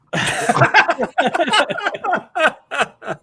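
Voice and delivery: long, high burst of laughter; hearty laugh. Real, not acted. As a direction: crack up at the joke. Laughing at the Benadryl joke.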